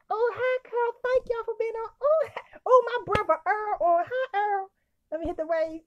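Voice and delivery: giddy high-pitched welcome; excited greeting. Real, not acted. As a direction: sing out each hello, bursting into laughs. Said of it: "I'm all excited".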